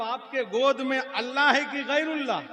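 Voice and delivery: high, loud demand; challenging question. Acted. Sunni challenging the cleric about the baby, loudly. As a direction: call him out in challenge.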